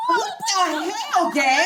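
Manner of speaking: high, loud, drawn-out cry of disgust; shocked yell. Acted. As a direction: recoil and yell at her. Shocked by the naked photo.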